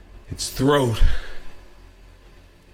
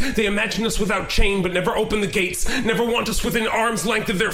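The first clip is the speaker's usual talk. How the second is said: rising, near-sung speech of a caged gorilla, higher and building; impassioned gorilla; 9 semitones higher than usual. Acted. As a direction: let the gorilla's pain swell into an almost chanted plea. Poet voices the gorilla's speech.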